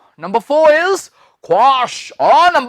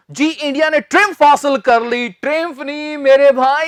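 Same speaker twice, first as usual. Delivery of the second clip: ordinary delivery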